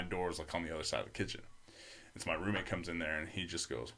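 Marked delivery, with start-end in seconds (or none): none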